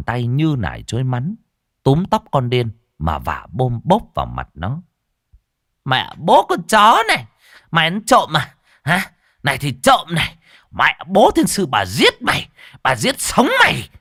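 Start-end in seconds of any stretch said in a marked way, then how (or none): 5.8-13.9 high, raging threats; furious cursing. A narrator's reading. Stall owner beating the girl who stole food, furious. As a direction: curse between slaps, voice raised. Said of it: "Bà vừa chửi vừa đánh con Điên".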